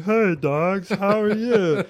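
lightly voiced, high, loud, friendly goofball; goofy greeting to dogs. Tripping teen cheerfully greets nipping dogs, mock-friendly high call. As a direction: chirp a cheery hello at the dogs.

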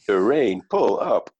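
loud mock alarm callout; robotic cockpit warning. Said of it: "mechanical voice going".

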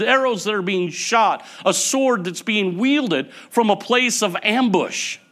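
high, loud, driving sermon voice; fiery preaching. Acted. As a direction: preach it hard to the church. Preaching on sarcasm, rising intensity.

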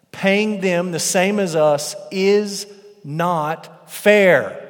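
loud, high, slow aggrieved protest; indignant complaint. Acted. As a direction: hammer home the unfairness. Reading the grumbling workers' complaint.

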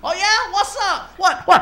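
high, loud taunting voice; mock street challenge. Trash-talking the car next to him at a light, high and loud. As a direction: taunt the other driver.

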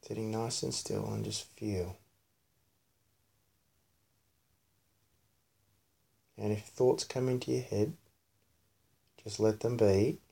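soft, quiet meditation voice; hushed, soothing body-scan guidance; guided meditation. Real, not acted. Leads child's guided meditation.

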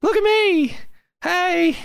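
very high-pitched little squeaky inner voice; tiny little voice. Acted. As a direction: pipe up as a tiny voice from the soul. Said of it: "this tiny little voice in my soul".

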